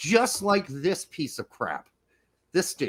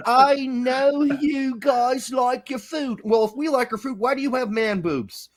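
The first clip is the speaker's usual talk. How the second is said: mock London accent; 6 semitones higher, 4 dB louder than usual. Host teasing British fans about food, mock London accent. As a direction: tease in a put-on London accent. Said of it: "You sound like you're from London".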